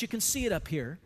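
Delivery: usual speaking voice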